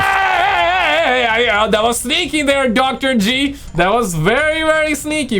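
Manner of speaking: high-pitched cheer, starting on a drawn-out shout; playful cheering. Real, not acted. Reactor teasing Doctor G about a sneaky ad, high playful cheer.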